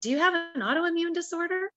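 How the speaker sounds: small, high voice posing a question; little voice. Retold or imitated. Little voice speaking to her at the pool, high and questioning. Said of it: "another little voice say".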